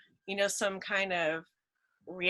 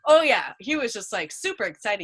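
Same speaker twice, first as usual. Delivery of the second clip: usual speaking voice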